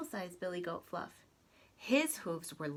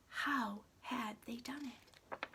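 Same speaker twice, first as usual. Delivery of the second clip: puzzled whisper; 4 semitones higher, 6 dB quieter, slower than usual. A narrator's reading. Troll puzzled.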